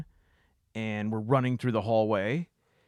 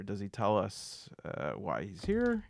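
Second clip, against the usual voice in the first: ordinary talk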